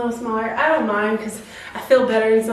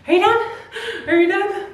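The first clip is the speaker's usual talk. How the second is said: playful teasing; 9 semitones higher than usual. Real, not acted. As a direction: tease her with a laugh. Teasing the dog.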